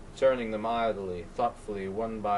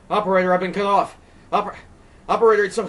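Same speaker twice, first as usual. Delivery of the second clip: higher, louder, fast panicked babble; frantic; 10 semitones higher, 11 dB louder, much faster than usual. Acted. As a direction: stumble over the words in panic. Frantic at the cut line.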